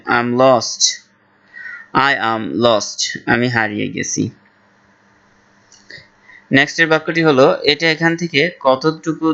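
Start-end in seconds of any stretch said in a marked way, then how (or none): 0.1-3.2 very slow, careful English; slow model phrase. Shown as a demonstration. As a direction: say it slowly for learners. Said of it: "ইংলিশে বলবেন". (ধীরে ধীরে স্পষ্ট উচ্চারণে)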